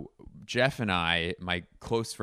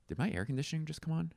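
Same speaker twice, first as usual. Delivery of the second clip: usual speaking voice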